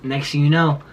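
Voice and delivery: plain reading voice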